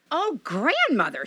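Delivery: put-on high, slow, wondering girl; a child's uneasy wonder; high hesitant girl's voice; little girl voice.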